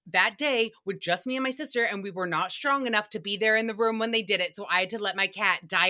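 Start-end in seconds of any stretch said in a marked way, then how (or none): none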